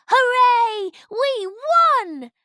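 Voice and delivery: very high, loud joyful shout; victory cheer. A narrator's reading. Leopold cheering after the wolf runs off, joyful shout. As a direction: cheer the win. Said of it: "shouted Leopold".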